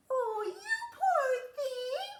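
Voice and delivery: mock kindly giantess squeal. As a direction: giantess pitying hungry Jack. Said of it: "boomed the giantess".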